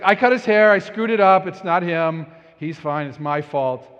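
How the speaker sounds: mock flustered dad; defensive explanation. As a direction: rush to take the blame.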